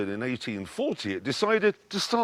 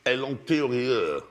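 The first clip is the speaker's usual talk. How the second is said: put-on French accent. Comedy sketch of French executives.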